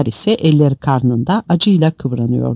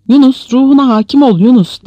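loud, high urgent appeal; urgent calling; 9 semitones higher, 5 dB louder than usual. A narrator's reading. Imam urges Yunus to control himself, raised voice. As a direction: call him back to his senses.

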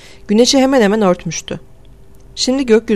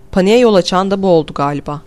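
plain reading voice

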